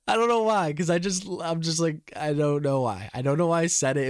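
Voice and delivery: high, giggling through the words; laughing. Real, not acted. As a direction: laugh while you explain. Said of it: "laughing while explaining".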